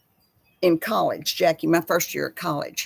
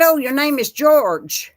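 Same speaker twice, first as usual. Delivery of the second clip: surprised; 6 semitones higher, 6 dB louder than usual. Retold or imitated. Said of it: "I said".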